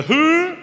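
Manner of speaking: loud, high-pitched rising "hoo" call; high mock alarm noise; mocking alert hoot. Shown as a demonstration. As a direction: sound a siren-like hoot to flag the crack. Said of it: "they'll hear the".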